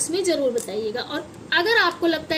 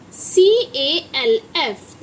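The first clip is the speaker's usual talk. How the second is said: each letter of C A L F spelled slowly and louder; drawn-out letter-by-letter spelling; 6 dB louder, much slower than usual. Shown as a demonstration. Teacher spelling CALF slowly to children. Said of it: "तो इसे क्या बोलते हैं हम?".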